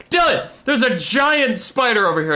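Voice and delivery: high, urgent call to a friend; alarmed warning. Retold or imitated.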